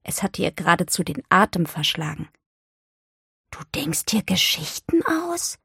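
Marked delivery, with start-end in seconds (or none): 3.5-5.6 hushed disbelief; stunned. A narrator's reading. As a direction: ask it as if you can hardly believe it. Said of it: "sagte sie fassungslos". (fassungslos staunend)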